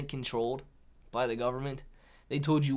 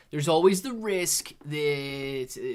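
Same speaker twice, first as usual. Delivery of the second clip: ordinary talk, nothing marked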